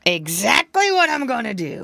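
crafty fox answering his captive; gloating fox. Acted. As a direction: savour the threat like a villain. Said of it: "replied the fox".

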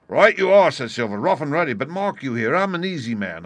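high, loud, hearty agreement; emphatic pirate leader. A narrator's reading. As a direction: agree heartily, then assert yourself. Silver agrees, then warns.

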